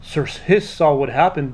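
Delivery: ordinary delivery, nothing marked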